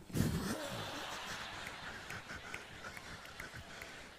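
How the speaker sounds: high breathless laugh; real airy laugh at himself; high breathy giggle. Real, not acted. Laughs at own blunder.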